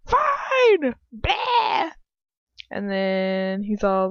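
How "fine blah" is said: high shouted huff; mock huffy shout. Heroine snaps back at the villain, mock shouting. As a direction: yell it like a sulky retort. Acted huffy retort in recap.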